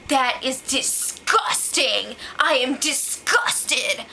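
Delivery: very slow, drawn-out disgusted drawl; mock her disgusted catchphrase; imitating Christine. Imitating Christine's famous line, slow mock disgust. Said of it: "pulls out her famous line".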